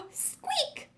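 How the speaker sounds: very high, soft squeak; rubber duck. Acted. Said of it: "said the little rubber duck".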